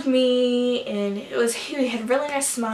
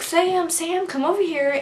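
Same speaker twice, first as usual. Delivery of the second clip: raised, higher calling voice; 6 semitones higher than usual. Retold or imitated. Girl calling Sam over to the fan, lightly imitated call.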